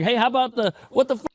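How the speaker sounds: high, exasperated what-the-fuck protest; indignant outburst. Retold or imitated. A man wrongly accused protests to everyone, high and indignant. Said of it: "It's like".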